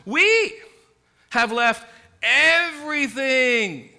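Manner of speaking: "We" hit high and loud, "everything" slow and stretched; stress on we. Retold or imitated. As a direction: lean hard on "We", then draw out "everything". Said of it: "this emphasis here on we and everything".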